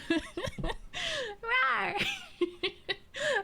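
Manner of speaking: high-pitched laughing fit; high cackle. Real, not acted. Laughing hard.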